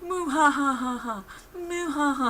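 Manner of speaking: slow, high hammed-up evil laugh; a cheesy cartoon-villain cackle; bad villain laugh. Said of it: "very, like, bad laughs".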